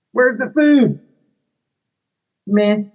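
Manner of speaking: high, far-off character whine; bored shark. Acted. Shark complains about book, high whiny meh. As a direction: ask for food, then shrug it off.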